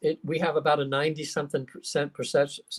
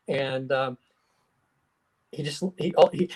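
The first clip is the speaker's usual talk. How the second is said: ordinary talk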